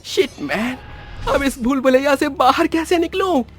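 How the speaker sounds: high, worried voice; anxious. Acted. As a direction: wonder in alarm how to escape. Lost alone in haunted maze. (परेशान, ऊँची आवाज़)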